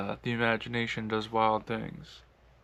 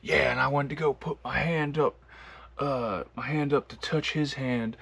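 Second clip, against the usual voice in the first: higher-pitched imitation of a Southern wife; Southern drawl; 4 semitones higher than usual. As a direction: retell it in a put-on Georgia drawl, lifting the pitch. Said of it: "they got a real deep Southern drawl".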